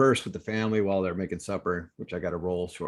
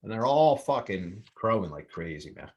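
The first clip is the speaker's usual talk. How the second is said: usual speaking voice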